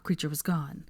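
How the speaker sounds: usual speaking voice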